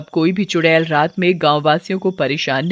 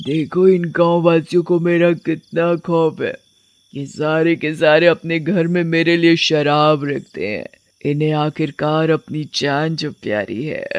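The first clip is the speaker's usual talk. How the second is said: smug, crooning witch voice; gloating witch; 3 semitones lower than usual. Acted. Witch mocks the villagers' fear of her, gloating. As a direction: gloat over their fear.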